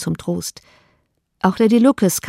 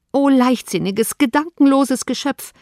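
exclaiming; 5 semitones higher than usual. Acted. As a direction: lift the voice in shocked reproach. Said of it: "rief Elizabeth".

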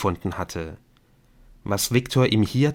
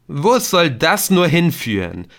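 raised, louder cry of theatrical despair; mock despair; 7 semitones higher, 6 dB louder than usual. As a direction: ham up the hopelessness, higher and louder. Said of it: "rief Viktor in gespielter Verzweiflung". (gespielte Verzweiflung, höher und lauter)

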